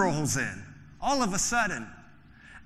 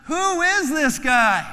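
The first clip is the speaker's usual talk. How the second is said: awed fright; 9 semitones higher, 8 dB louder, slower than usual. Acted. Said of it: "they asked each other".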